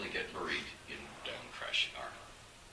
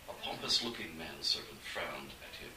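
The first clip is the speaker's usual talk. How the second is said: ordinary delivery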